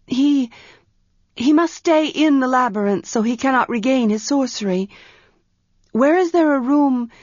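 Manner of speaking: put-on a young priestess pressing for an answer; Arha's voice. Young priestess Arha urgently asks her servant for a room. Arha thinks aloud anxiously.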